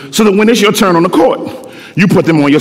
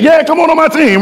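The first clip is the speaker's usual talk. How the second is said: higher easy insistence; lightly voiced invite; 8 semitones higher than usual. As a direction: reassure her she's wanted. God insists.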